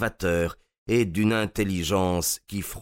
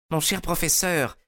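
high-pitched alarmed appeal; exclaiming; 9 semitones higher than usual. A narrator's reading. Bennett steps in to calm the furious professor, high exclamation. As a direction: exclaim it high, pleading for sense. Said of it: "s'exclama-t-il".

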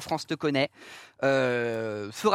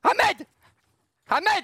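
shouting his name; 13 semitones higher, 4 dB louder than usual. Real, not acted. Scolding guest for edgy joke.

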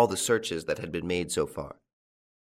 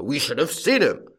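louder, grumbling farmer; growled; 6 semitones higher, 5 dB louder than usual. A narrator's reading. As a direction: push back with a growl. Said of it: "growled Farmer Gusso".

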